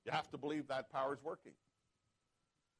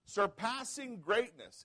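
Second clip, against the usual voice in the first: surpassing drawn out slow, higher and louder; stress on surpassing; 9 semitones higher, 7 dB louder, much slower than usual. Shown as a demonstration. Pastor urging the church to say it, surpassing stressed. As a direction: lean hard on surpassing, slow. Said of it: "say surpassing".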